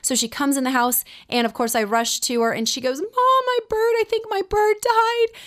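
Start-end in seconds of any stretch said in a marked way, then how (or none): 3.0-5.4 put-on high, wailing child's voice breaking with tears; imitating bawling daughter. Daughter rushing in to mom, wailing over her dead bird. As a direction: play the sobbing little girl, much higher. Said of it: "And she's bawling".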